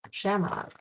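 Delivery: ordinary talk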